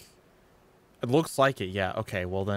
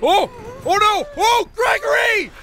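alarmed cry; 9 dB louder than usual. Real, not acted. Alarmed at game character.

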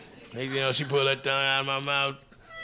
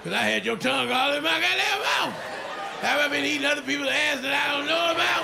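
demanding shout; 9 semitones higher than usual. Retold or imitated. Comic confronting the woman, demanding shout. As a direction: press her for the truth.

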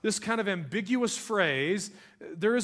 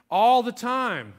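all the time slow and louder; slow emphasis; 5 semitones higher, 7 dB louder, slower than usual. Acted. Preacher driving home a point, slow and loud.

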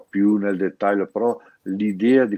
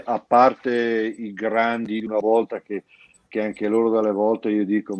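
normal talking voice

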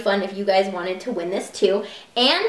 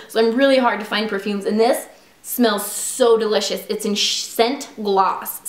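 ordinary talk, nothing marked

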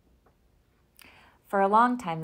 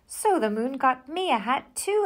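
exclaiming; 6 semitones higher, 7 dB louder than usual. A narrator's reading. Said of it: "exclaimed Bear".

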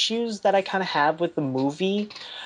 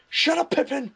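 mock angry outburst, pitched way up; Gandalf; 10 semitones higher than usual. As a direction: quote the wizard's sudden high snap. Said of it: "I actually quoted, he went there, it's just like".

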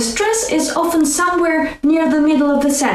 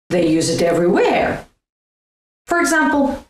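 normal talking voice

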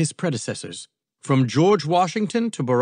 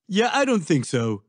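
higher, mildly huffy dismissal; 7 semitones higher than usual. A narrator's reading. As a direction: huff it off as nonsense. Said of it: "huffed Trump".

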